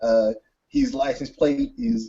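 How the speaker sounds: high, lightly voiced radio call; cop on the radio. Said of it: "his radio and he calls for backup".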